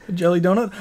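high-pitched incredulous question; Gunny. Acted. Drill sergeant questioning a recruit's donut, incredulous. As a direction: imitate a drill sergeant asking in disbelief. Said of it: "What about Gunny from, uh, Full Metal Jacket?".

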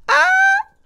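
sudden high yelp of pain; pained shriek. Real, not acted. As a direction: yelp as the fire burns you. Burned by fire in game. (højt smertensskrig)

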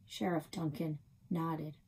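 usual speaking voice

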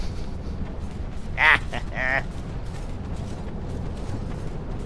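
real laugh after a whoop; amused laugh. Real, not acted. Gamer amused after the drop, laughing. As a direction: laugh it off, loosely.

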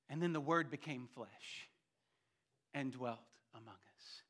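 soft, low scripture recital; quiet, reverent preacher's hush; hushed reverence. Real, not acted. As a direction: drop down low and let it settle. Hushed climax after raised preaching.

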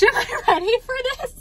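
high, half-laughing mock panic; overwhelmed excited question. New owner asks herself, high overwhelmed excitement. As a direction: pitch it way up as if overwhelmed.

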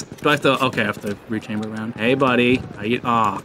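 ordinary delivery

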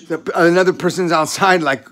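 ordinary talk

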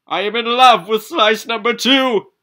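high, loud love declaration; swooning high confession to the pizza; lovestruck character voice. Acted. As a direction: belt it out like a swooning hero.

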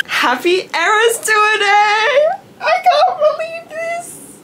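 very high, slow squeal close to crying; teary excitement. Real, not acted. Fan greeting viewers on concert day, teary and squealing. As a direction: gush it out, about to cry.

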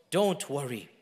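very slow, soft, low comfort; hushed promise fading almost away; tender reassurance. Retold or imitated. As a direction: soothe gently, with long pauses. Retelling God's comforting message.

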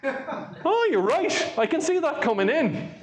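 high-pitched voice, imitating a phone exchange; acted phone reply. Engineer and caller spotting rain coming in, acted phone reply. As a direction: pitch it well up, acting out the call. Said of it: "phone call to the engineer".

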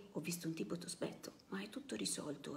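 hushed whisper, almost no voice; whispered excuse. Acted. Comrade teaching the excuse to give the boss, in a whisper. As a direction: whisper it like a secret.

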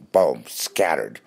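usual speaking voice

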